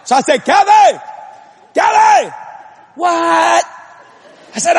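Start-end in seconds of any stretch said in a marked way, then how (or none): 0.5-2.3 high, raised call to his wife. Retold or imitated. Husband under the house calls his wife for help, calling out. 3.0-3.7 high, drawn-out answering call; imitated wife calling back. Wife answers husband's call from upstairs, imitated calling back.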